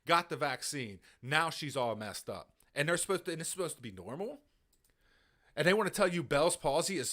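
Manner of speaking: ordinary talk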